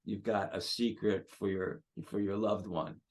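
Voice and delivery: soft, hushed secret for a loved one; gentle, secretive. Shown as a demonstration. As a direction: let the voice drop, holding back a secret. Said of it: "make it kind of gentle".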